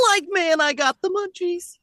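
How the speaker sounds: high, goofy stoner cartoon voice; lazy cartoon slacker; Shaggy impression. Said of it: "into that mimicry".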